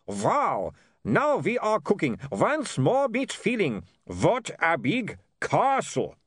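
put-on high, gleeful vampire with foreign accent; excited vampire. A narrator's reading. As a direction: revel in it, drawing out the accented words. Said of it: "jumped up and down excitedly".

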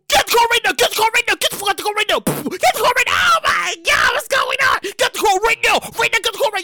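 high, urgent barked commands; shouted police orders. Acted. Police ordering people out of a car, high urgent commands.

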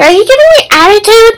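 high, loud mock scolding; angry mom imitation. Mom confronting her child, mock angry. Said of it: "I was like".

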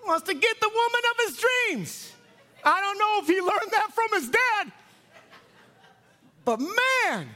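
high, loud, shouted punchlines; full-force comic preaching. Real, not acted. Preacher dad revealing his son's crush, shouting. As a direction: holler it to the crowd in gleeful disbelief.

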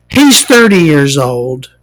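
ordinary delivery

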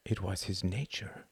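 breathy whispered suspense; a tense storyteller's whisper; hushed narration. A narrator's reading. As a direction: lean in and whisper the tension.

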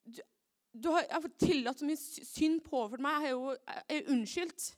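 loud, high, desperate shouting; furious cry to God; angry yelling at God. Retold or imitated. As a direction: rage at God, voice straining high. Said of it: "Og jeg ble så sint".

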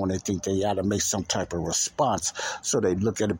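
normal talking voice

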